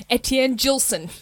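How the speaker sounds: Etienne Gilson in a broad Australian accent; Australian-accented name, no French sounds. Shown as a demonstration. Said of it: "In my Australian accent".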